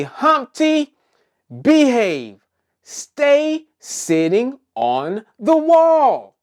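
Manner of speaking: high, loud call with drawn-out words; playful scolding. Pretend. Host leading children in telling Humpty to behave, playful scolding. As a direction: tell Humpty off in a high, emphatic voice.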